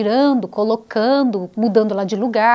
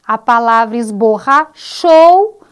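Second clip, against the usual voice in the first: esborrachou drawn out slowly and louder, final ou stressed; teacher spelling out the rhyme word, slow and loud; slow loud example; 9 dB louder, slower than usual. Shown as a demonstration. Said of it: "a rima viria no ou".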